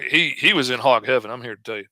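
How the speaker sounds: ordinary delivery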